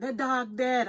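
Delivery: put-on high, loud, blunt island-accented exclamation; a sudden, blunt retold line with a touch of Caribbean accent; mock Caribbean blurt. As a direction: toss it out in a hint of Caribbean accent. Quoting the character's blunt island side.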